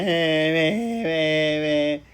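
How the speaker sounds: loud, fake boo-hoo sobbing sounds; teacher acting out a crying child for the class; mock crying wail. Shown as a demonstration. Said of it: "ऐसे करता है".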